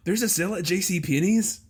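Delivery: high-pitched camp astonishment; flamboyant mock shock. Imitating the office guy reading the headline, flamboyantly aghast. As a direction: gasp it out like scandalous, thrilling news.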